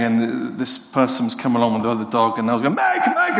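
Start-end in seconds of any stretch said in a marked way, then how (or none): 2.7-3.4 calling out for the dog. Retold or imitated. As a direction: shout her name across the field. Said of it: "like, shouting".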